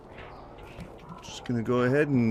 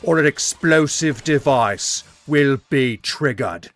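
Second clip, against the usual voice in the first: slow, louder hammed-up Australian threat; mock Australian; 6 dB louder, slower than usual. Gamer voices a bomb villain giving the shop a deadline in Australian. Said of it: "Why did he turn Australian".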